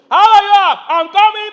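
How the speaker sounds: loud, high yelling greeting; mock household shouting; family yelling. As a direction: holler it like the whole house always talks this way. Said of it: "Everybody yells".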